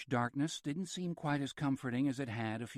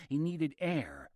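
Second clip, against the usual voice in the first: usual speaking voice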